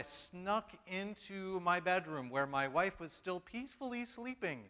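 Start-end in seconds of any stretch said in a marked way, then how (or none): 3.4-4.6 peacefully sleeping. Shown as a demonstration. Preacher teasing that his wife was not quite asleep, high and soft. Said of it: "or trying to".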